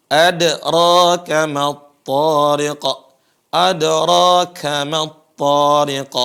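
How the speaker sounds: "Adraka" chanted loud and slow with a bounced d; qalqalah on dal. Shown as a demonstration. Teacher drills the dal, chanted "Adraka" with echoing d. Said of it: "dia harus qalqalah".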